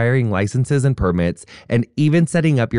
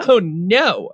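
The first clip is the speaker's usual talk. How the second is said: mock dismay; 7 semitones higher than usual. Mock alarm in ad read.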